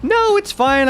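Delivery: high, loud voice of the friend; high imitated friend. Retelling the friend's phone call, high and loud. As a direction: squeak it out brightly as her. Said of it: "She's like".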